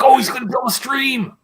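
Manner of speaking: mock excited voice. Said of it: "It's like".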